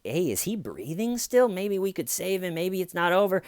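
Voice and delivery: put-on concerned caller voice; feigned concern. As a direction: act hopeful that he can be saved. Acting out hopeful concern as caller.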